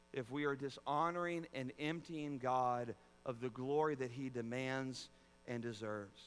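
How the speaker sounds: ordinary delivery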